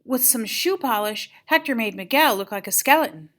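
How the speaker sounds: ordinary delivery, nothing marked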